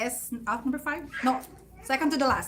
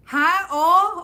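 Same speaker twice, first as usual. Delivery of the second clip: put-on loud, higher careless child answer; rude loud reply; 7 semitones higher, 11 dB louder than usual. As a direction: blurt it back like a sassy kid. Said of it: "I was like".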